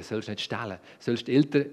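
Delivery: ordinary delivery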